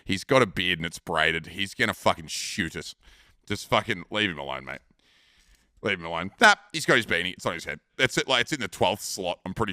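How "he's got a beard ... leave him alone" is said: low, dragging warning voice; low warning to mate. Retold or imitated. Teller to his drunk mate, low pleading warning. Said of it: "And I'm like".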